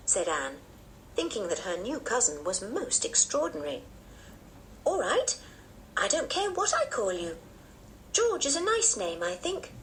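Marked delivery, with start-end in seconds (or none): 4.8-9.7 put-on high-pitched, easygoing child; agreeable little girl. A narrator's reading. Anne accepting the name George, high agreeable child. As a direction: concede lightly in a high girlish voice.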